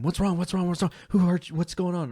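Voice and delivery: high, loud, rapid alarmed questions; frantic parents. Retold or imitated. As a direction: panic like a parent hearing a scream.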